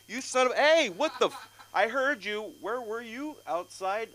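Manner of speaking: high, loud outraged scolding; angry shouting. Retold or imitated. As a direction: yell at the kid who mouthed off. Relative scolding him from outside.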